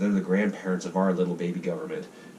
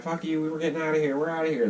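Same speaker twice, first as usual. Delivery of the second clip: high, fast mock rebel voice; a hammed-up young country leaving home; defiant brush-off; 9 semitones higher, faster than usual. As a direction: toss it off like a teen storming out. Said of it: "The U S is like".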